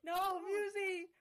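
pleading cry. Pretend. Friend playfully threatening to jump off a cliff, pleading cry. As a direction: mock-desperate cry, high and loud.

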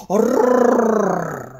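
loud dog growl rrrr. Acted. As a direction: growl like a big shaggy dog. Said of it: "закричал пёс".

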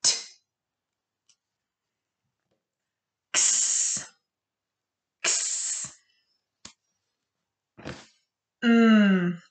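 crisp isolated phonics sounds, each pure and clipped; speech therapist. Shown as a demonstration. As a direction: say each letter sound short and pure, no added vowel. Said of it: "I'll say the sound and then you repeat".

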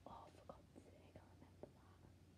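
barely voiced whisper under the breath; muttering to self. Real, not acted. Vlogger grumbling to self over an old book, muttered whisper. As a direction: whisper it to yourself, almost inaudible.

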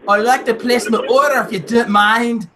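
mock-polite Scottish customer; Scottish accent. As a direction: put on a playful Scottish brogue. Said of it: "I haven't used Scottish in a while".

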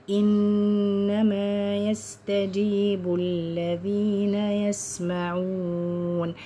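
slow, drawn-out tajweed chant; Quran recitation. Shown as a demonstration. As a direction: recite with long held vowels and nasals.

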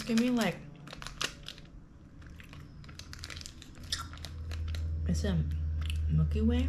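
muffled chewy speech; chewing while talking. Real, not acted. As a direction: mouth partly full of candy. Seen 2: Bit the bar at -7; lips closed and chewing while talking.

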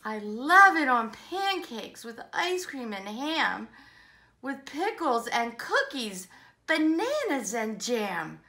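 bouncy rhyming read-aloud; enthusiastic story reading. Acted. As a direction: relish each rhyme for young listeners.

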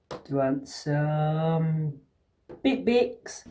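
slow, soft coaxing to a cat; coaxing pet voice. Real, not acted. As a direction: sweet-talk the cat.

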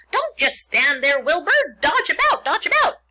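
loud, high squawking goose voice; goose crying orders. A narrator's reading. The goose taking command of Wilbur's escape, high loud cries. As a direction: shriek commands like a frantic goose. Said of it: "cried the goose".

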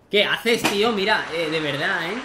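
loud, exasperated yelling; angry yelling. Real, not acted. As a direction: vent at the game in fury. Yelling at the game.

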